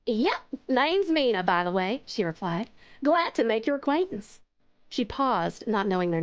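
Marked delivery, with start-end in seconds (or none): 0.1-2.0 slow, easygoing Southern introduction; Southern accent. Acted. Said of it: "Mina is Southern". 3.0-4.4 put-on Southern accent. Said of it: "Mina is Southern".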